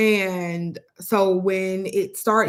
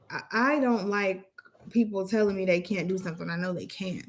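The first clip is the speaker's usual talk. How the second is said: ordinary talk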